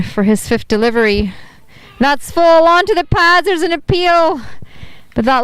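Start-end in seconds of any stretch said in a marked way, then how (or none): 2.0-4.4 high excited appeal call; excited commentary. Real, not acted. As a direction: rise sharply for the appeal.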